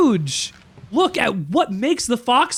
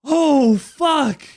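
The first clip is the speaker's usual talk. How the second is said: surprised shout; 7 semitones higher than usual. Real, not acted. Sudden realisation while watching.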